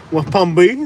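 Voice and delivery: "West Palm B" with only B for Beach; local clipped place name. Shown as a demonstration. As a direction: cut "West Palm B" short at the B, tune lifting. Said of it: "Just the B".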